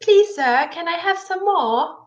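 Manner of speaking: high-pitched, pleading mock London accent. Shown as a demonstration. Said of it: "a London accent".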